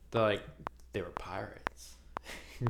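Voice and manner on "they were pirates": whisper. Real, not acted. Secretive aside about pirates.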